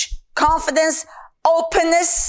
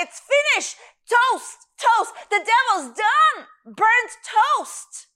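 higher-pitched, punched-out FINISHED and TOAST; emphatic; 5 semitones higher than usual. Shown as a demonstration. Preacher declaring the devil finished, emphatic. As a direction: hammer TOAST and DONE as final verdicts. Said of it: "That's how empha".

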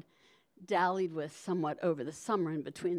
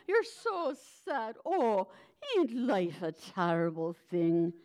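Polly Garter line; slower than usual. Retold or imitated. Reciting Polly Garter's line, crooning to the baby. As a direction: croon it like a lusty washerwoman to a baby. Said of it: "the line was something like".

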